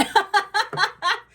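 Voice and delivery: very high loud giggle; high laugh. Real, not acted. Laughing at Spirit's joke, high loud giggle.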